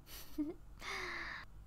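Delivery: small satisfied chuckle; soft chuckle. Acted. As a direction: let out a hushed, pleased laugh. Vampire pleased with obedient human.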